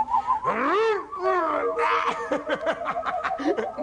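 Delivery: man cackling at his own trick; wild laugh. Acted. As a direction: laugh out in triumph. Triumphant display of power.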